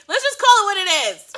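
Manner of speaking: high, loud, emphatic call-out; exasperated outburst. Real, not acted. Host calling out an actress's bad accent, loud and exasperated. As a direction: belt it out like a blunt verdict.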